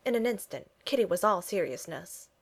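ordinary delivery

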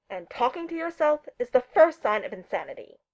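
grumpy yelling. A narrator's reading. Neil shouts from his chambers, grumpily. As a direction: complain loudly from another room. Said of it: "Neil's muffled voice yelled grumpily".

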